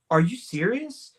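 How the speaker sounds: retold disbelief. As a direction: act out not believing your ears. Retold disbelief at lost memory.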